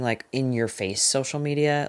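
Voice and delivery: ordinary talk, nothing marked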